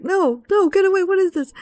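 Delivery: very high, frightened squeal; mock terrified fairy cornered by purple cabbages; panicked sylph. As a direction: shriek at the attackers to back off. Retold friend surrounded by enemies.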